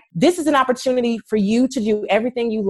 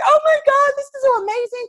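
teary makeup artist; 3 dB louder than usual. Retold or imitated. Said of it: "she just bursts into tears".

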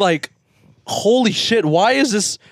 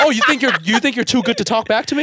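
mock angry parent; 8 semitones higher than usual. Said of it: "They're like".

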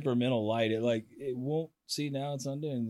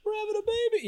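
high squeaky mock wife voice; imitating wife on text; 4 dB louder, faster than usual. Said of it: "I don't know if it's like".

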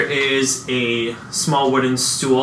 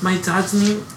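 put-on little girl voice; 5 semitones higher than usual.

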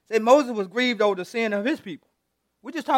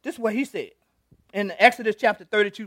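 usual speaking voice

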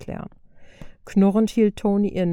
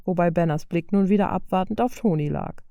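plain reading voice

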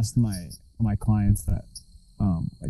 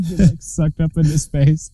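ordinary delivery

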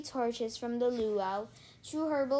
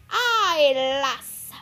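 loud, gruff pirate reply; growling pirate; 3 semitones higher, 10 dB louder than usual. A narrator's reading. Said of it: "the big man growled".

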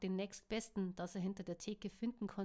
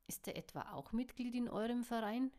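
plain reading voice

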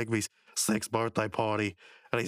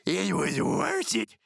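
high, very slow put-on voice; Muscle Man; 7 semitones higher, 3 dB louder, much slower than usual. Prankster just thrown out by the boss, slow high mock satisfaction. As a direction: savor it slowly in a cartoon voice. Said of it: "then Muscle Man says".

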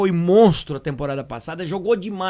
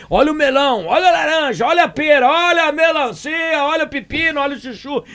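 high-pitched sing-song street vendor call; market barker; 7 semitones higher than usual. Pretend. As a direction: hawk fruit like a market stall vendor. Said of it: "tá igual feira". (pregão de feirante, voz aguda e cantada)

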